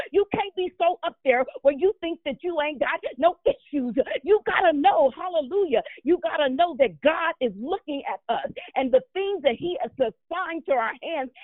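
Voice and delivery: ordinary talk, nothing marked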